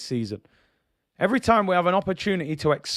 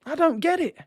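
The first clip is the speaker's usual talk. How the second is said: much higher, sighing disbelief; sigh, then a high baffled cry; exasperated disbelief; 12 semitones higher than usual. Real, not acted. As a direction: throw your hands up in despair. Exasperated rant about transfers.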